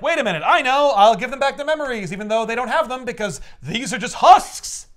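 hammed-up eureka moment; Rachel. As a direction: announce a bright idea urgently, slowing on the last words. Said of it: "Rachel is like".

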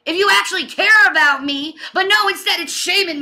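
impassioned angry scolding. Real, not acted. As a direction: raise your voice in outraged protest. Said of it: "I'm raising my voice".

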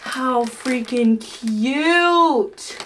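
usual speaking voice

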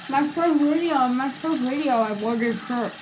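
toothbrush in mouth; talking while brushing teeth. Real, not acted. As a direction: mouth full of toothpaste foam. Seen 0: Toothbrush held in foamy mouth throughout, brushing before and after.

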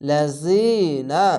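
লাজিনা very slow with the long i held; long i stretch. Shown as a demonstration. Teacher showing one-alif stretch, very slow chant. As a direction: draw out the ee in লাজিনা. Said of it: "এক আলিফ টেনে পড়বেন".

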